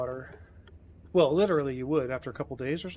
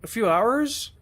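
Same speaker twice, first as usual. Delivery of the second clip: higher, louder, unsure mock-kid voice; imitating a sixteen-year-old's clueless guess; clueless teen reply; 4 semitones higher, 5 dB louder, much slower than usual. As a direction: play the kid guessing, sure of nothing. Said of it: "And he says".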